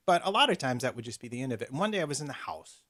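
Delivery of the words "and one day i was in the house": ordinary delivery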